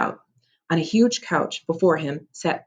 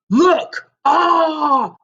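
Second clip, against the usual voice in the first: loud high shout of surprise; startled cry; 11 semitones higher, 7 dB louder than usual. Acted. Cyclops spots the boys, loud startled cry. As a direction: yell on spotting them.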